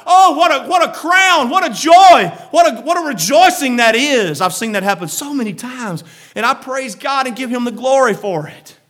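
loud, high preaching build; preaching at full force. Real, not acted. Preacher to the church, rising in fervent shout. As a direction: shout the joy of the gospel out.

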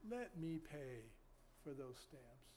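quoted offer, softening to very quiet; quiet retelling, fading at the end; retold gentle offer. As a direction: let the stranger's words sink almost to a hush. Stranger's offer repeated softly.

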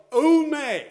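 "ome" called out high and loud; Greek word. Shown as a demonstration. Preacher names the Greek word, "ome" sung out high and loud. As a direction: give "ome" a ringing high cry. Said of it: "It's called".

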